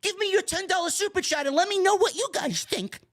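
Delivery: high, sneering parody of a streamer begging for super chats; mocking streamer voice. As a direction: lampoon the streamer's fake sales pitch. Mocking greedy streamers' pitch.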